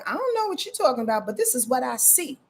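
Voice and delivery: a wide-eyed innocent blurting what it sees; lightly voiced carefree moon; voicing the moon.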